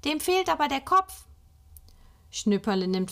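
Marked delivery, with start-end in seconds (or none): 0.0-1.3 higher, timid peep; squeaky. Acted. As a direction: peep it out like a little child. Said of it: "piepst Mutter".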